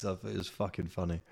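ordinary talk

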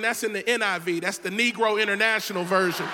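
usual speaking voice

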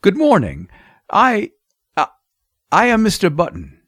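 embarrassed, hesitant self-introduction; nervous stammer. A narrator's reading. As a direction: falter and restart on "I". Ashamed father stammers to nurse.

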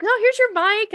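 high, bright voice; mock sweet handover. Giving the mic back to the speaker, high and bright.

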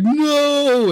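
high, sharp cry of no; imitating cry. Ironhide crying out at Megatron, high sharp cry. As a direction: yelp it like a doomed hero.

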